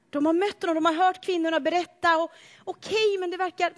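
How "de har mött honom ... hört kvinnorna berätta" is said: ordinary delivery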